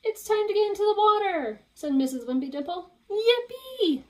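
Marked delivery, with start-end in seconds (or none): 0.1-1.6 high, loud, quick teacher announcing; cheery teacher call. Acted. Teacher calls the class. 3.1-4.0 high, loud squeal of delight; cry of joy. Acted. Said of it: "cried Plank".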